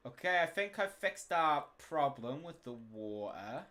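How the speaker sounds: normal talking voice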